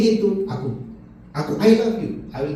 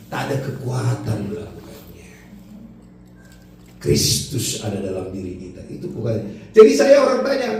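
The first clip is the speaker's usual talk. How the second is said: normal talking voice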